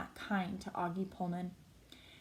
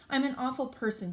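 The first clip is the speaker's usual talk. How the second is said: higher, mildly tearful confession; through tears; 6 semitones higher, 4 dB louder than usual. A narrator's reading. Olivia confesses to Justin, through understated tears. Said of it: "she says through her tears".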